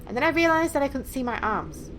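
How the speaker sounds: normal talking voice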